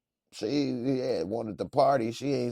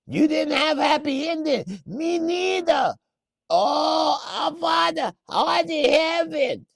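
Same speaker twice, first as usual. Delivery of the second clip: put-on very high, loud accented pleading; panicked shopkeeper; 7 dB louder, slower than usual. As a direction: beg like a terrified clerk.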